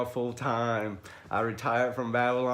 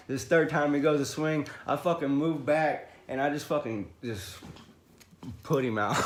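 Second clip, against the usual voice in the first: normal talking voice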